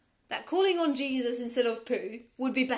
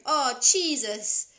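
drawn-out and louder; slow drawn-out "Oh, Jesus"; 6 dB louder, much slower than usual. Retold or imitated. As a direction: stretch it out like a little kid. Child voiced in story, repeated.

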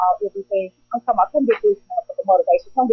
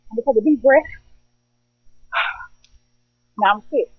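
usual speaking voice

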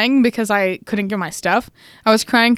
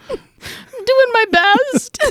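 much higher, slow, hammed-up blubbering; mock sobbing; 11 semitones higher, slower than usual. Mocking her own meltdown to mom, hammed-up sobbing. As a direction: wail it like a toddler in tears.

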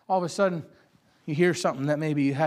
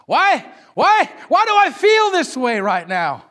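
emotional outburst; 9 semitones higher, 10 dB louder, slower than usual. Retold or imitated. Voicing an emotional churchgoer.

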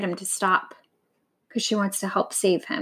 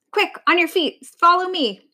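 high-pitched hurried urging; rally him to move now; urgent command; 7 semitones higher, 4 dB louder than usual. A narrator's reading. Vulture getting the old lion up, urgent command.

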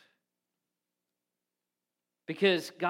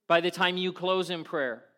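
ordinary delivery

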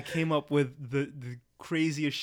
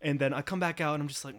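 ordinary delivery

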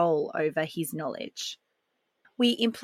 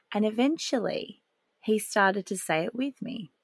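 ordinary talk

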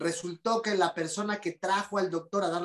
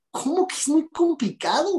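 incredulous protest; 10 semitones higher, 5 dB louder than usual. Retold or imitated. Confronting the doctor, exasperated.

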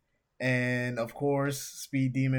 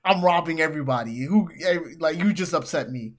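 mock tantrum; 3 semitones higher, 7 dB louder than usual. Host voicing Speed Demon threatening the pet shop, loud mock tantrum. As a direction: blow up like a sulking villain. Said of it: "he goes".

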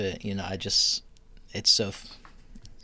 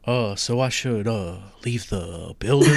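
[name]; 4 dB louder than usual. Acted. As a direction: do a half-hearted singer impersonation with uhs. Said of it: "I'll do [name]".

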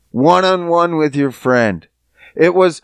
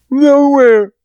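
yelped, higher and louder; high loud nowhere; 8 semitones higher, 4 dB louder than usual. Retold or imitated. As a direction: blurt it out high. Teen answering while fleeing.

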